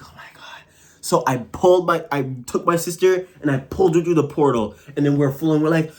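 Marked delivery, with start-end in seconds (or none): 0.0-0.6 barely audible whispered oh my God; dismayed whisper. Retold or imitated. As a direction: mutter it under your breath in disbelief. Realizing her sister is drifting again.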